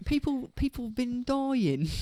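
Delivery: high, slow, loud mock London voice; mock Cockney lament; bad British accent. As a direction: stay in the cartoon cop voice. Continuing accent bit.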